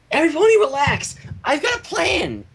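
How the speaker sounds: high, bright, joyful call-out; joyful announcement. Acted. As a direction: announce it like great news. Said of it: "Pinkie announced joyfully".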